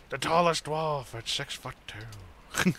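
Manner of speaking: slow, drawn-out dwarf boast; mock dwarf voice. Player joking about being a tall dwarf, mock dwarf voice. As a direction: put on a dwarf, stretching the words.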